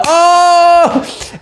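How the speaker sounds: high, loud group cheer; excited oh. Retold or imitated. The friends all cheering together, loud and high. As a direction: whoop like a crowd. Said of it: "they all start going like".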